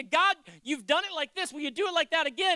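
loud, high plea to God; acted prayer, pressing and forceful; earnest appeal.

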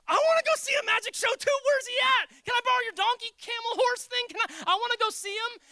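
high, loud, hammed-up childish eagerness; mocking thrill-seekers; mock eager crowd. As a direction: play a gawker begging to see tricks. Mocking a thrill-seeking household.

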